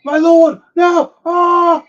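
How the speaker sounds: high, loud desperate shout; 1 panicked knights. Acted. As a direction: knights crying out for their lord. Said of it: "the king's knights".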